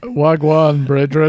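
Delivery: Jamaican patois greeting; Rasta accent. Pretend. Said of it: "do it in a Rasta voice".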